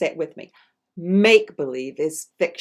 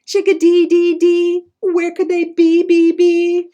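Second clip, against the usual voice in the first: much higher, louder birdlike chirping; tiny bird singing its call, much higher; chickadee voice; 13 semitones higher, 11 dB louder than usual. Acted. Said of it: "Help me with that".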